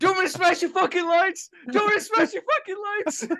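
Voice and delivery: very high-pitched mock raging threat; hammed-up tantrum at the crew. Imitating the actor threatening the lighting tech, mock furious.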